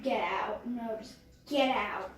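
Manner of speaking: low, quiet irritation; real, subdued annoyance; pretty mad. Real, not acted. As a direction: grumble softly at the stuck stuff. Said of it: "tell I'm pretty mad right now".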